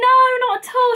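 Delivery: lightly voiced cheery recruiter; reassuring recruiter. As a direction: wave away the apology brightly.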